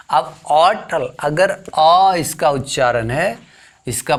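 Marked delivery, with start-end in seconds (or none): none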